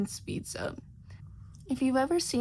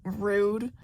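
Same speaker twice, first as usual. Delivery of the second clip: wrathful; 5 dB louder than usual. A narrator's reading. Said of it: "puffs away wrathfully".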